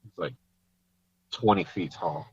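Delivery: hushed aside, barely voiced; quiet prompting. Real, not acted. Dad quietly prompting his daughter with the answer, hushed. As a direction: slip her the answer under your breath.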